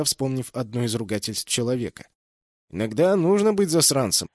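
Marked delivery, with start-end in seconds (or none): 2.7-4.3 lecturing. A narrator's reading. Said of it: "наставительно произнёс".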